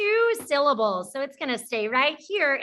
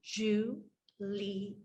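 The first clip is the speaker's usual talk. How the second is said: "Ju-li-a" in three soft, separated beats; syllable by syllable; 3 semitones lower, 9 dB quieter than usual. Shown as a demonstration. Teacher shows the chin-drop method, syllable by syllable. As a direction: softly break "Ju-li-a" apart, pausing between syllables. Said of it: "Anytime your jaw drops down".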